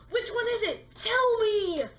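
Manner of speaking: shouting in frustration, high and loud; angry outburst. Real, not acted. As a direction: yell at the login screen. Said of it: "I just get really mad".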